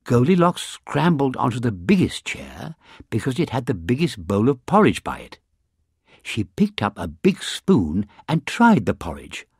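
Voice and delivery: ordinary delivery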